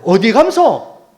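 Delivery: slow, high, loud question in Jeju speech; Jeju dialect. Retold or imitated. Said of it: "제주도 말로". (제주도 말투로 길고 크게 묻기)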